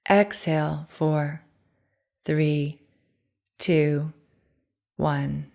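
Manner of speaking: soft counting meditation voice, fading to quiet; guided breathing meditation. Acted. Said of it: "I'm offering a breathing meditation right now".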